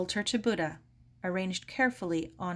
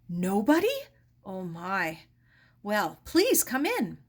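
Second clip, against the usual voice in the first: nervous; 5 dB louder than usual. Acted. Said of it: "Helen smiled nervously".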